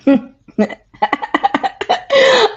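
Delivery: loud, high burst of laughter; real laughter. Real, not acted. Co-host reacting to the actress's statement, laughing out loud. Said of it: "I'm laughing because".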